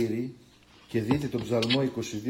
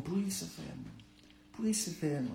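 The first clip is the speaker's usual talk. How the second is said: hushed, despairing plea to God; anguished prayer; 10 dB quieter, faster than usual. Retold or imitated. Believer alone in the dark, hushed and despairing. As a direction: murmur it like a lost soul calling out.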